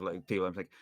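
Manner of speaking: ordinary delivery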